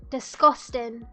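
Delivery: ordinary talk, nothing marked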